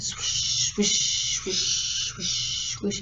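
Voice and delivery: soft unvoiced swishes, fading; hushed imitation of a breeze; wind swishing. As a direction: swish gently like wind in trees. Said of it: "the wind in the trees outside went".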